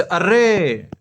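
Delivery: slow exclamation demo. Shown as a demonstration. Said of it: "ऐसे चिन्ह होता है ना एक".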